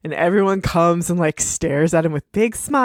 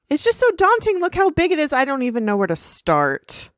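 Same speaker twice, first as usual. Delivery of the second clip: high, fretful cartoon voice; high overwhelmed pony; 8 semitones higher than usual. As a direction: sound swamped by the big castle. Twilight overwhelmed.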